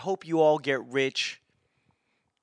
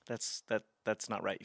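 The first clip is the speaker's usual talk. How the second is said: ordinary talk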